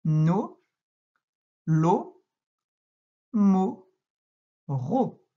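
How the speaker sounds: each syllable read out alone, evenly spaced; slow syllable reading. Shown as a demonstration. Teacher reading syllables aloud, one by one. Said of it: "Donc je les relis, tu peux suivre".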